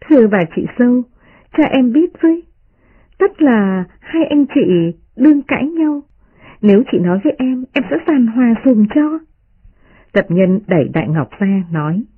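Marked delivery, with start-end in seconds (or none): 0.0-9.2 much higher, playful mocking girl; teasing young lady. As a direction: tease them with a laughing lilt. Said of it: "lại vỗ vào vai Tập Nhân cười nói".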